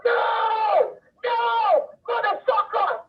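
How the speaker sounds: high, drawn-out mock screams at no extra volume; imitated screaming. Woman imitates Ace screaming at the mover on the phone, high mock screaming. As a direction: mimic someone shrieking curses, stretching each word. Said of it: "Ace immediately started going".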